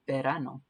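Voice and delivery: "Verano" with a B-like V and a tapped R; final model "Verano"; Spanish pronunciation. Shown as a demonstration. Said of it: "that R again is like a D".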